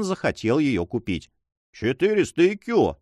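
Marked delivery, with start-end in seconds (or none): 1.7-3.0 defiant bid, called out higher; challenging shout at the rival bidders. A narrator's reading. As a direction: throw the number down as a challenge. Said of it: "закричал он вызывающе".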